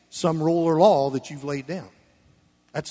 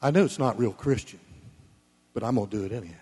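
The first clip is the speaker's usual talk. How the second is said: imitated spiritual excuse; 7 semitones lower, much faster than usual. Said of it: "we like to make it spiritual".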